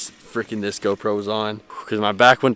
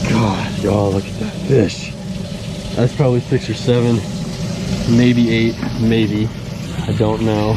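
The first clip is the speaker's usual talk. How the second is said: louder, real excitement while sizing up the fish; excited; 4 dB louder than usual. Real, not acted. Angler guessing the bass's weight, excited. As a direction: keep it loud and worked up.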